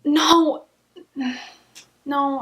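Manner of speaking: ordinary delivery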